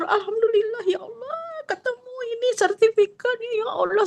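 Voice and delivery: high, tearful cry of gratitude to God; tearful thanks. Retold or imitated. Retelling her prayer on finding the certificate, tearful and high. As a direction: sob out thanks on finding the lost deed. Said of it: "Sambil bersyukur".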